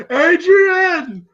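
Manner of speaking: high, drawn-out holler; Sylvester's call. Retold or imitated. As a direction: imitate a boxer calling out for his wife. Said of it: "Sylvester's going".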